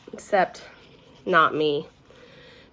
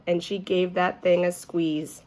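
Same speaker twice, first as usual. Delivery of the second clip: usual speaking voice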